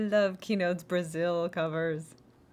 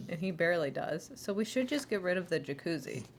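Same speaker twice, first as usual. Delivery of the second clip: ordinary talk, nothing marked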